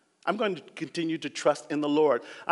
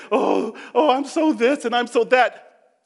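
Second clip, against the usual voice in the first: mocking complainer; 8 semitones higher, 8 dB louder than usual. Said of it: "we need to stop saying".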